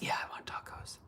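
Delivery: hushed, whispery swoon; swooning reply. Acted. Fan answers her crush's imagined offer, swooning whisper. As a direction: answer him under your breath, smitten.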